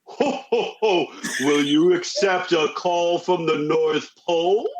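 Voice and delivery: jolly ho-ho-ho, then slow booming phone greeting; Santa voice. Retold or imitated. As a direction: play Santa drawing out each word. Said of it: "he is Santa. So he calls me".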